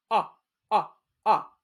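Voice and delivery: Ah, ah, ah as the short vowel /ɑ/ said alone; isolated short o. Shown as a demonstration. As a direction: sound out the middle vowel of dog. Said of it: "what is the middle sound in".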